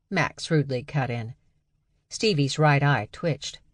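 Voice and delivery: ordinary delivery, nothing marked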